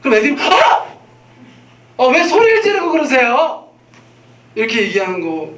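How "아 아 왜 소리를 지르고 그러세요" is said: put-on much higher, louder whiny child protest; high complaining child voice; whiny kids. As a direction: whine back at dad like a startled kid. Children whining back at dad.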